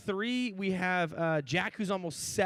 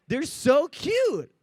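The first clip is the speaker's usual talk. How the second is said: gushing; 7 semitones higher, 7 dB louder, much slower than usual. Retold or imitated. Gushing over baby hippos.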